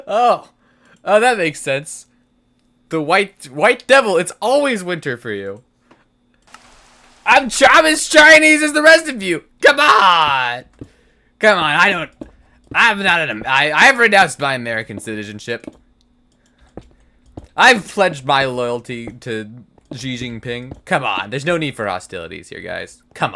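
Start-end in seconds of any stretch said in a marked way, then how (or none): 0.1-5.6 high, loud mock outrage; exaggerated offended outcry. Realizes, mock offended shout. 7.2-20.8 high, loud pleading protest; hammed-up plea to be accepted; overdone loud protest of loyalty; mock protest.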